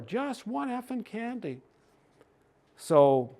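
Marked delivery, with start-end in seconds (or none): 0.0-1.6 high, slow imitation yell, held soft; mock child yell. Niece begging mom in the store, mock high yell. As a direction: mimic a little girl's drawn-out yelling, without the volume. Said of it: "she was yelling in the store".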